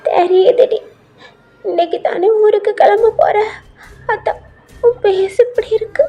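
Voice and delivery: high, weepy granny voice; acted tearful old woman; tearful grandmother. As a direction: speak as if starting to cry. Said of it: "எதுக்கு அழுதுட்டு இருக்கீங்க".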